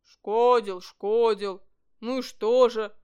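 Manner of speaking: loud, heavy indignation; indignant bear cub. A narrator's reading. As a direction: protest loudly as an outraged bear cub. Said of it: "возмутился медвежонок". (громкое возмущение медвежонка)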